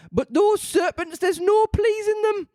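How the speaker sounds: pigeon complains about serpents; high pigeon voice. A narrator's reading.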